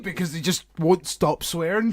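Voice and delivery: normal talking voice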